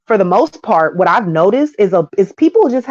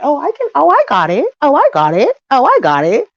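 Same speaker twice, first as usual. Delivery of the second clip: lightly mocking high-pitched voice, swooping up on each repeat; mimicking over-eager provider; 7 semitones higher than usual. Woman insisting she can provide for her man, mock-eager. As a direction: imitate a woman too quick to volunteer.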